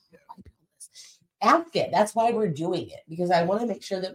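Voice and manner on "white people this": hushed whisper; whispered gossip. Retold or imitated. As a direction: whisper it behind closed doors. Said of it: "we're like".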